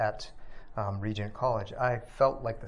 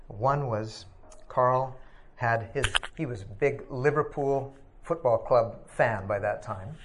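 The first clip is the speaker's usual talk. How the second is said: ordinary delivery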